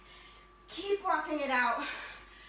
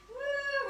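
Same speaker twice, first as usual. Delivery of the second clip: high, drawn-out woo; playful whoop; 10 semitones higher than usual. Real, not acted. Instructor pretending to swim at beach, playful whoop. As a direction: let out a high swimming whoop.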